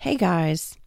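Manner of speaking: usual speaking voice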